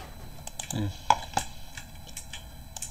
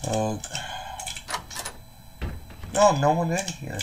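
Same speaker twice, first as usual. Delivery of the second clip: usual speaking voice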